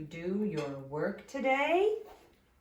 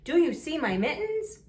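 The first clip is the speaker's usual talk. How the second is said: higher, louder question lifting on MITTENS; question rise on MITTENS; 8 semitones higher, 5 dB louder than usual. Shown as a demonstration. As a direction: ask it brightly, voice up at MITTENS. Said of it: "your voice goes up at the end".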